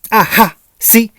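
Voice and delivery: gleeful I-told-you-so exclamation; triumphant. A narrator's reading. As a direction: crow it like winning an argument. Gleeful I-told-you-so amid laughter.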